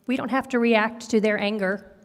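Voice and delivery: usual speaking voice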